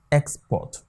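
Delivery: export as a noun, EX-port; stress on first syllable. Shown as a demonstration. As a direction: slowly hit EX in export, then drop on port. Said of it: "the first syllable will receive the stress".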